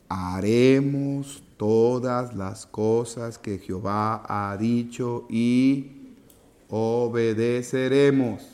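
slow, low, measured recitation; solemn vow. Acted. The people's pledge to Jehová, slow and solemn. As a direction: recite it solemnly, drawing out each word.

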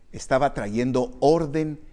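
ordinary delivery

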